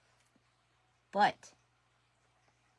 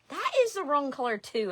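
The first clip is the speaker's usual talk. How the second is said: higher and louder sudden realisation; exasperated surprise; 10 semitones higher, 13 dB louder than usual. Real, not acted. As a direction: blurt it out in dismay. Realising she grabbed wrong blue.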